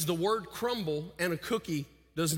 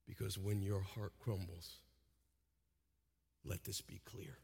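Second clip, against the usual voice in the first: much softer, fading to a whisper; hushed close; 7 semitones lower, 8 dB quieter than usual. Acted. Pastor ending the sermon quietly, fading to a whisper. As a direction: bring it down to a hush.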